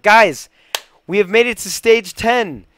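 loud, high, triumphant call; excited announcement. Real, not acted. Gamer tells viewers they reached stage ten, loud excited announcement. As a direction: proclaim it proudly to everyone.

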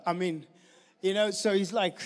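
normal talking voice

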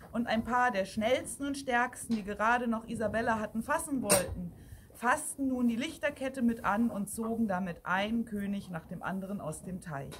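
ordinary delivery

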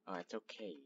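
ordinary delivery

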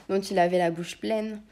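ordinary delivery

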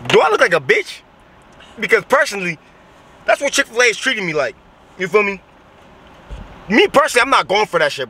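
indignant. Real, not acted. Mock-offended rant about Chick-fil-A.

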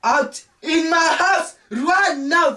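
enraged drawn-out yell; slow furious shout. Acted. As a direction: spell out the order in rage. Third order to leave, slowed.

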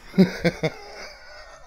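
mocking laugh. Reflection laughs.

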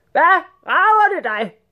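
high, loud, drawn-out yell; shouted retort. A narrator's reading. Sultan rebuking the rat general, shouting. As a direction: shout back as an irate sultan. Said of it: "råbte sultanen".

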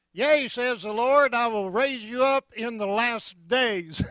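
hammed-up Arkansas country prophet; Arkansas accent prophecy. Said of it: "I'd do this in an Arkansas accident".

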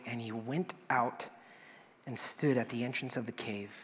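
normal talking voice

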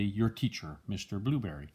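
ordinary delivery

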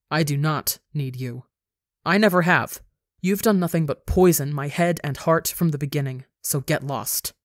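ordinary delivery

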